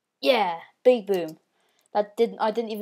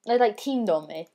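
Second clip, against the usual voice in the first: normal talking voice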